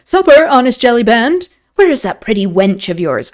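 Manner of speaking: hearty call, loud at first then easing; jovial lord. A narrator's reading. Lord calling the innkeeper for supper, jovial. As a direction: open big and high, then settle. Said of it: "Lord Antony's jovial voice".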